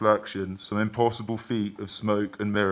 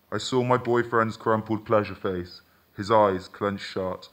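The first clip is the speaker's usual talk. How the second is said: ordinary delivery, nothing marked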